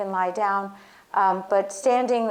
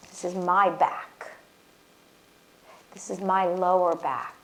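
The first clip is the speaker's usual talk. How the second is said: guided healing exercise, gentle; guided affirmation. Acted. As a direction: lead them calmly through each body part. Guided body reclaiming exercise.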